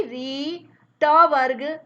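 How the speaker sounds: री said clearly, त वर्ग loud; sounding out letters. Shown as a demonstration. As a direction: say री, then call त वर्ग out loud. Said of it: "इसका अर्थात ये है री". (री और त वर्ग का स्पष्ट उच्चारण)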